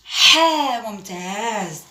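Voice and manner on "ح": loud letter sound. Shown as a demonstration. Teacher calling the letter ح loudly to pupils. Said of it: "teacher models letter sound".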